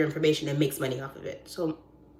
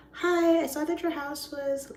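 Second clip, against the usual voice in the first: high, slow, tentative sales call; mock cold-call greeting; cold caller; 9 semitones higher, slower than usual. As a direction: open a cold call in a raised voice.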